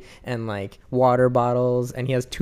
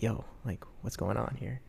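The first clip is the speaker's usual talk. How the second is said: much softer, hushed concern; worried hush; 13 dB quieter than usual. Retold or imitated. Friend quietly questioning the route in the back seat, hushed. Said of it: "Frost's like".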